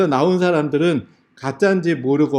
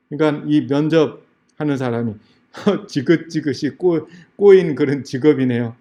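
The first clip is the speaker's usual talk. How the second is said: normal talking voice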